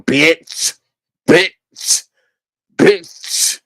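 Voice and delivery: over-emphasized, unconvincing swear word; mock awkward cursing. Mocking how Jay Wilson curses, awkwardly over-emphatic. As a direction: imitate someone trying too hard to cuss. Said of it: "it just don't sound right".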